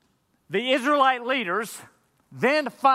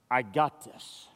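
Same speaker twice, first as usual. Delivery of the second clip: a lower, softer hint of God's voice; God reassuring; 10 semitones lower, 6 dB quieter, slower than usual. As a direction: gently reassure the worried listener. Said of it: "God comes to you and says".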